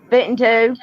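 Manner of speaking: Fitting to said as fittin' to, dropped g; Southern fitting to, fittin' tuh. Shown as a demonstration. Tennessee speaker demonstrating fittin' to. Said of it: "when you say fixing to, you don't say fixing to, it's fitting to".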